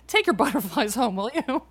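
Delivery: teasing through a laugh. Real, not acted. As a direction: laugh while scolding playfully.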